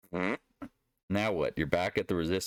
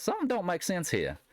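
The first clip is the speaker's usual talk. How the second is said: much higher, put-on Southern accent; Southern roots voice; 12 semitones higher than usual. Trader joking about a chart that looks off, Southern voice. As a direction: say it high in a folksy Southern voice. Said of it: "I'm talking my Southern roots voice".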